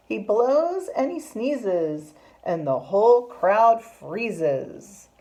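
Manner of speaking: slow, emphatic rhyming narration; story reading to children. A narrator's reading. Teacher reads of the bear's big sneeze, slow dramatic storytime voice. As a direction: savour each rhyme for young listeners.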